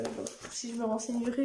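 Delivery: normal talking voice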